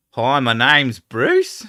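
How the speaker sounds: slow, loud Aussie voice ending on a rise; Australian upward inflection. Retold or imitated. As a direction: say it like a caricature Aussie named Bruce. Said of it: "ends with an upward inflection".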